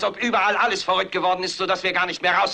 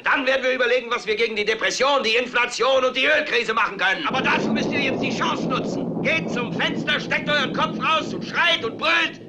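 fiery preaching; 6 semitones higher than usual. Acted. Anchor preaching to the nation.

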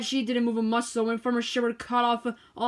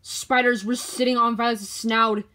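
ordinary delivery, nothing marked

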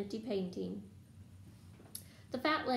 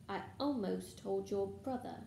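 plain reading voice

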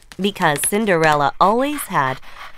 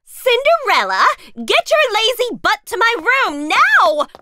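bratty stepsister; 13 semitones higher than usual. Acted. Stepsister ordering Cinderella to her room, high and bratty. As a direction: yell for the maid like a spoiled brat.

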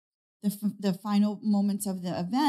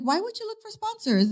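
high, disbelieving why; retelling a podcaster's scoff, pitched high; incredulous question; 13 semitones higher than usual. Retold or imitated. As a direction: act baffled that she would ask. Retold man questioning her plans.

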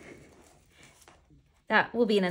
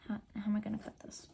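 fast whispered question to herself; a hurried, puzzled whisper to yourself; 9 dB quieter, faster than usual. Real, not acted. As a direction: whisper it quickly under your breath. Muttering alone over ripped box.

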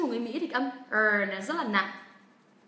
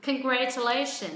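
Congratulation faster and firmer; quicker drill of Congratulation; speeding up; slower than usual. Shown as a demonstration. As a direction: pick up the pace on Congratulation. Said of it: "bắt đầu tăng tốc".

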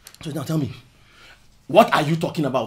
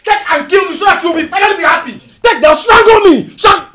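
bitter shout. Acted. Husband dares his wife to kill him, bitter shout. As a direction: dare her, shouting.

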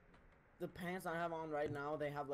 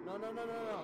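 scared; 7 semitones higher, 3 dB louder, faster than usual. Real, not acted. Panicking in horror game.

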